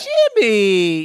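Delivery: very high, loud, gushing; high mock mom voice. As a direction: gush like a mom touched by a gift. Voicing the mom.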